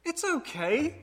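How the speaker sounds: lightly voicing a toddler reassuring dad; a hint of a small boy, matter-of-fact; child's voice. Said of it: "and he goes".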